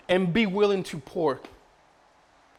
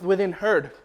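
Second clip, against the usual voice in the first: normal talking voice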